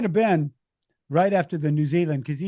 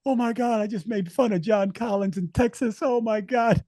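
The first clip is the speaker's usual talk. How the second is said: high-pitched, laughing dismay; mock horror; 7 semitones higher than usual. Speaker recalls mocking Texas before John Collins, in high-pitched mock horror. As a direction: replay your horrified realization in a high voice. Retold horror at own gaffe.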